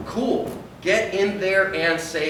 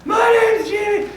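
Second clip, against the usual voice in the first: put-on much higher, loud slow kid voice; scared little boy; 6 dB louder, slower than usual. Lost boy Jimmy answers the stranger, high, loud and slow. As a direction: answer like a frightened child.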